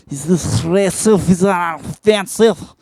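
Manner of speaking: a hammed-up Eastern European fortune-teller accent. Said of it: "I'm not good with accents".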